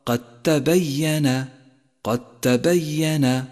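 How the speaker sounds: قد تبين said slowly as qat-tabayyana; slow قد تبين with no d heard; merged dal. Shown as a demonstration. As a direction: drop the د of قد into a doubled t. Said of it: "لا تلفظ في حالة الوصل".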